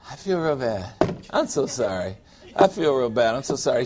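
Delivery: mumbled mock sorrow on repeat; recorded self-pity. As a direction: play it back like a tired recording. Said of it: "Get a recording and hit that every time".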